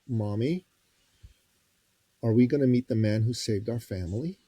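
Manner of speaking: put-on earnest, measured question from a boy; very serious child. Twelve-year-old boy questioning his mother, very serious. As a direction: ask it gravely, pausing after Mommy. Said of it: "with this very serious twelve-year-old face".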